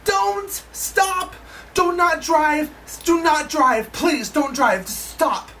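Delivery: high, pleading cries that fade to a plea; retelling how she yelled at her friend to stop; frantic warning. Retold or imitated. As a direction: beg her not to drive off. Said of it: "And I'm like".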